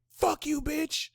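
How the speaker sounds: squeaky high rude snap, "Fuck you, bitch"; mock rude insult. A Mars in Aries sign telling someone off, high mock snap. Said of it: "instead of, like, saying".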